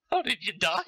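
normal talking voice

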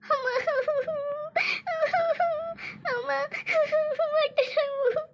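high, strained moans of pain, long gaps between; crying in pain.

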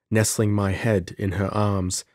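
ordinary delivery, nothing marked

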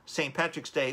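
normal talking voice

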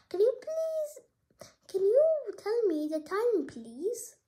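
high, slow pleading farmer voice; a small high voice begging the time; farmer asking politely. Acted. Farmer asks clock the time.

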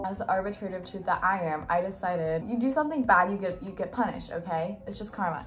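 ordinary talk

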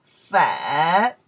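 粉 loud, low dip then rise; teacher's exaggerated falling-rising 粉; third tone. Shown as a demonstration. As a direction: trace the full dip of the tone on 粉. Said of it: "the third tones".

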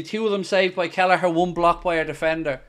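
ordinary talk

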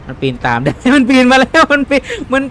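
panicked high yelp. Real, not acted. Gamer panicking as the boss climbs up after him, high yelp. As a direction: pitch jumps up, words tumbling out in alarm.

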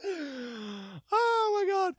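very high, drawn-out panicked wail; mock terror. Man terrified to find he is moving, high wail.

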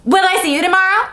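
high, sudden mock demand; abrupt demand. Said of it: "she demanded abruptly".

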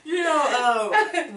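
high laugh sliding into slow words. Real, not acted. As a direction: laugh it out before telling. Big laugh before story.

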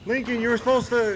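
loud, high exasperated yell; frustrated shout. Real, not acted. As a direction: yell at your teammate. Dad yelling at son.

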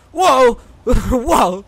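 loud, high startled whoa; startled yelp. Real, not acted. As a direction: yelp out in sudden shock. Startled by the horror game.

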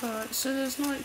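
high-pitched game heroine, chatty; lively high character voice; Faith voice. Acted.

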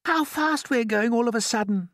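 high-pitched ladybird voice; ladybird character. Acted. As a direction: voice a wondering ladybird, pitched well up. Ladybird speaks in story.

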